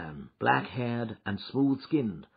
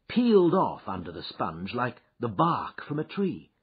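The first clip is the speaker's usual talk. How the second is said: plain reading voice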